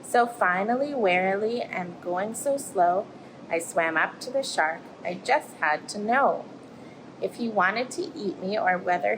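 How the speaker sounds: lively storybook voice for little ones; reading to children. Acted. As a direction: tell it like a bedtime tale, rising and falling. Reads picture book to children.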